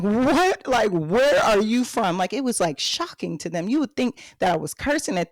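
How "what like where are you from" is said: startled, louder Texas-puzzled question; surprised customers. Retold or imitated. Shoppers stunned by the greeter's accent, asking loudly. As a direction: act taken aback by a stranger's accent. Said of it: "they'd be like".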